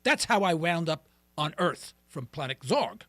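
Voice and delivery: ordinary talk, nothing marked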